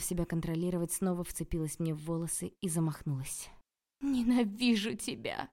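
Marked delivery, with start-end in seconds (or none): 4.0-5.5 slow, raised, tearful hatred; hissing through tears. A narrator's reading. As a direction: hiss it with tears in the voice. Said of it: "прошипела она сквозь слёзы".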